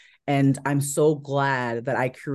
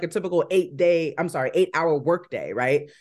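normal talking voice

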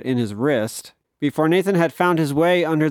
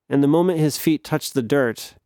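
usual speaking voice

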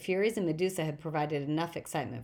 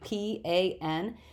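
"P A N" each letter spelled out slowly and firmly; "P A N" spelled slowly to correct a mistake; much slower than usual. Acted. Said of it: "he cried indignantly".

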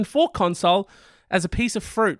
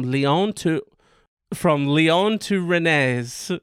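very slow, drawn-out French place names; put-on French accent; much slower than usual. Shown as a demonstration. Host reading flight route, slow French accent. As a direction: stretch Lyon and Rennes in a French accent. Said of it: "the accent's back. Do you love it".

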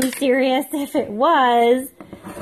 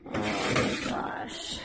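slow whispered fretting; hushed, drawn-out dismay; 14 semitones lower, 10 dB quieter, much slower than usual. Real, not acted. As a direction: breathe it out quietly to yourself. Fretting to herself over baking.

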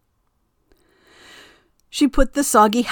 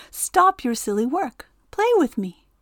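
playful Wind; 6 semitones higher than usual. A narrator's reading. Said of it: "laughed Wind".

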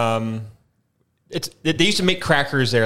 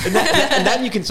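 laughing; 11 semitones higher, 3 dB louder than usual. Real, not acted. Laughing at gum talk.

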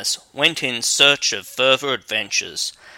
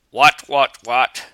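croaking frog; 5 dB louder than usual. Acted. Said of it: "a frog sat down beside it and croaked".

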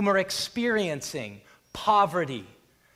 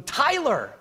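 crying out a name; 8 semitones higher, 5 dB louder than usual. Retold or imitated. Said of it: "falling on his face and going".

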